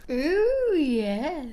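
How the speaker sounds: delighted ooh. Acted. Lizzie delighted at invitation.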